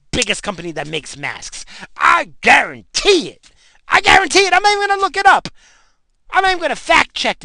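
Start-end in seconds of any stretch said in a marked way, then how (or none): 2.0-5.5 loud, rising, defiant shout. Real, not acted. Insisting Fauci profits from masks, defiant shout. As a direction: yell the guarantee.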